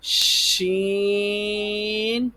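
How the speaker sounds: شین opened with a long sh, vowel held high; sh first, then sustain شین on one note; long sh sound. Shown as a demonstration. Teacher models the sound for pupils, drawn-out شین. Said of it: "اس طرح نکالیں گے تو".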